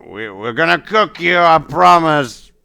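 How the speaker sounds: shouting threat. Acted. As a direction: holler a cooking threat at fishes. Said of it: "keeps shouting there, like".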